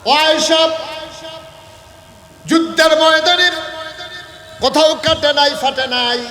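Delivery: high, loud ringing call with drawn-out endings; full-force preaching. Acted. Preacher voicing the Prophet calling to Aisha, full-force preaching. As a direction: cry it out over the whole congregation.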